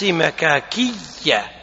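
مكاكية drawn out slowly with a doubled Y; very slow, deliberate ma-kaa-KIYY-a for مكاكية. Shown as a demonstration. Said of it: "بإثبات الياء لا بد من التشديد".

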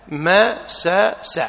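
"مسس" split into ma, sa, sa, very slowly; root spelled out. Shown as a demonstration. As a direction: give each letter of "مسس" its own a-syllable. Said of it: "أصل كلمة مس".

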